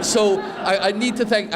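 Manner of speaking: high, strained, near tears; forcing the words out through tears; tearful. Real, not acted. Son thanking his parents in his speech, tearful. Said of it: "I get emotional at silly things".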